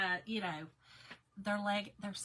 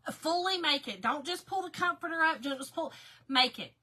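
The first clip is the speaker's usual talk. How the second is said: louder, higher, insistent instruction; emphatic urging; 6 semitones higher, 7 dB louder than usual. Real, not acted. Vlogger urging a friend to make her bed, emphatic. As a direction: drive it home, pitched up on "Fully make it".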